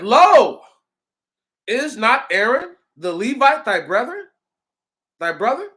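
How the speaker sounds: high, loud rebuke in scripture reading; angered Lord. A narrator's reading. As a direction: thunder the opening word, then press the question. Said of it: "the Lord was greatly angered".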